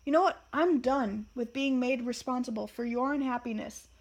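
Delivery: father finally snaps at his son; exasperated dad shouting back; losing his temper. Acted. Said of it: "Harry, finally losing his temper".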